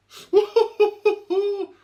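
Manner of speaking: sudden high burst of laughter; loud high laugh. Real, not acted. Laughs while eating.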